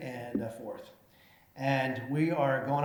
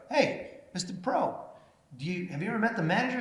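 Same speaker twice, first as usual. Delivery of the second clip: higher, quick, playful hail; teasing call-out; 7 semitones higher than usual. Retold or imitated. The speaker teasing the new golf pro, higher and quick. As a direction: rib the new guy, bright and fast.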